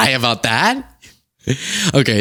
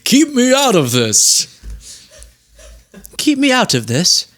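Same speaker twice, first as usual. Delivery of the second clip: Jesus impression; 11 semitones higher than usual. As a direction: play a comic Jesus, pitched way up. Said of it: "What's my Jesus impression?".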